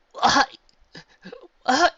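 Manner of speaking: loud, high choked-up cry; pleading through sobs on her shoulder; sobbing cry. A narrator's reading. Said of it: "Dash hiccuped".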